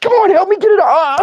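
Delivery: very high comic cry for help; mock desperate plea. Man to friend over at the driveway, mock desperate plea. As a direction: beg in a hammed-up high voice.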